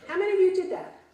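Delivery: ordinary talk, nothing marked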